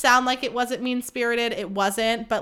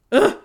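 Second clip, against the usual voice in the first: short groan of self-disgust; 7 semitones higher, 8 dB louder than usual. Real, not acted. Podcaster demoted to hot girl, disgusted groan. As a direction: groan at yourself.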